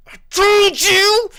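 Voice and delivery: slow, very high, loud shriek; vindicated scream. Retold or imitated. Child to grandmother on stuck coaster, vindicated shriek. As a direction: screech it in hammed-up vindication.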